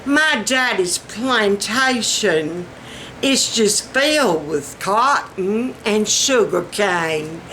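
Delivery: slow, drawn-out rich Southern drawl; genteel, leisurely rich Southern. Shown as a demonstration. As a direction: play the wealthy Southern planter's daughter. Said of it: "Or rich Southern".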